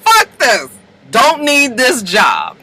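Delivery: high, drawn-out mock outburst; acted fed up. Marcus quits after the fight, slow emphatic exasperation. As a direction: stretch each word out in disgust.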